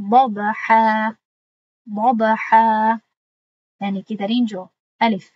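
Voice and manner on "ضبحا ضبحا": slow, drawn-out Quranic chant; slow tajweed recitation, louder. Shown as a demonstration. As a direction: recite it with the long vowel held.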